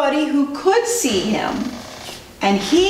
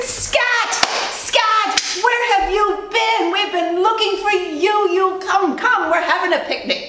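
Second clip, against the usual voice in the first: excited calling; 10 semitones higher than usual. Acted. Family spots the missing Scat, high excited calling. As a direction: call out much higher, as if across a field.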